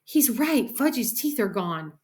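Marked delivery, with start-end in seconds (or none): 0.0-0.8 put-on higher-pitched girl's dismay; understated scream; alarmed cry. A narrator's reading. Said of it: "Sheila screamed".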